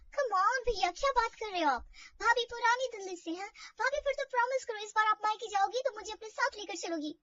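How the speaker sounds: high, eager, pleading to bhabhi; bubbling over with excitement; excited. Acted. Sister-in-law begs bhabhi to take her along, high and eager.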